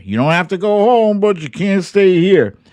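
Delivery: hammed-up tough bouncer kicking you out; mock bouncer voice. To evacuees, playing the bouncer who throws them out, hammed-up and loud. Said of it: "like, saying".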